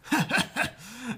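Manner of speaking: put-on small wheezy old man chuckle; a breathy little wheeze of a laugh; wheezing chuckle. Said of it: "gave a small wheezing chuckle".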